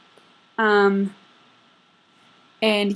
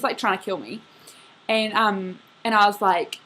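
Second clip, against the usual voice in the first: usual speaking voice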